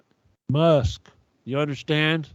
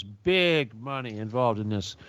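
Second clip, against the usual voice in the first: quieter, slower repeat; 5 semitones lower, 6 dB quieter, slower than usual. Shown as a demonstration. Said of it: "there's big money involved in this".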